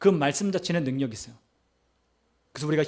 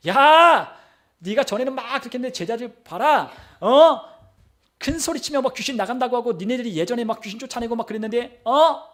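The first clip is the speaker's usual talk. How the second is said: loud, high-pitched mocking crowd; acted-out heckling, raised voice; jeering taunt; 6 semitones higher, 5 dB louder than usual. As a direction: taunt them loudly with challenging "huh?". Acts out jeering scribes.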